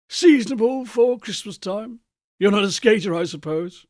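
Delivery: chatty businessman. Acted. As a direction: make polite conversation about the weather.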